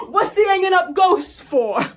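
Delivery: high, loud imitation of the genie; genie impression. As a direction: ham up the genie's puzzled line. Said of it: "one of my favorite lines".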